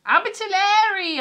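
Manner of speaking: Abertillery! called out loud and high; excited exclamation. Real, not acted. Reacting to a viewer's comment, loudly delighted. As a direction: greet the town name with delight. Reading a viewer's town from chat.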